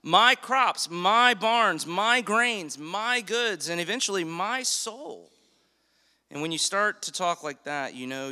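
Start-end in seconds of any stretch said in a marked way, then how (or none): 0.0-5.3 each "my" punched loud and high; stress on my. Shown as a demonstration. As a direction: hammer every "my" in the list. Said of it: "something's really off with this guy".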